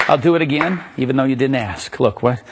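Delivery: ordinary delivery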